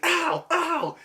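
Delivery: high yelps of pain; sharp, very high ow ow; pained cries. Retold or imitated. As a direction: cry out ow like it suddenly hurts. Character in pain, acted.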